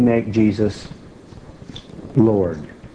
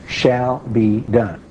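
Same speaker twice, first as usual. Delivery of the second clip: "Shall be done" in three long, separate words; emphatic; 2 semitones lower, much slower than usual. Real, not acted. Preacher insists the promise is certain, emphatic. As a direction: hammer home "Shall be done" very slowly. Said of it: "We can't emphasize that too much".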